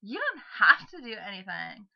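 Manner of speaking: loud, swooping character voice slipping into imitation; Dodger-like character voice. Trying out a switched character voice, loud slip into imitation. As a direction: push it out loud, pitch jumping.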